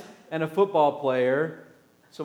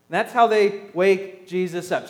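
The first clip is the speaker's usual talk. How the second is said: usual speaking voice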